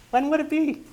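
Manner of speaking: high, bright question; agent's question. Retold or imitated. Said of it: "door script".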